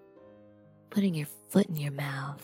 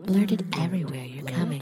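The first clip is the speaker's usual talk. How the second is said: ordinary delivery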